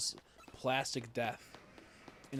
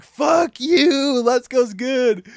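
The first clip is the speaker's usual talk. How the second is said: loud high shout, then slow; playful shout; 12 semitones higher, 17 dB louder, much slower than usual. Retold or imitated. Arguing with Crystal about Let's Go, loud mock shout. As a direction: bellow the defence of the game. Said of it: "I'm like".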